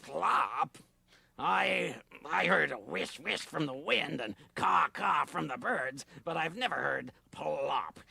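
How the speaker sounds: a slow, dopey beaver grumbling; slow dim beaver. Acted.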